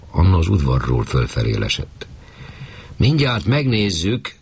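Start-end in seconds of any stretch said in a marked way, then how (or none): 3.0-4.3 high, slow call from the rooftop. A narrator's reading. Chimney sweep calls down from the roof, high slow shout. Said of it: "kiabált le Báthori úr".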